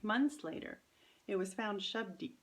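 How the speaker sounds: ordinary delivery, nothing marked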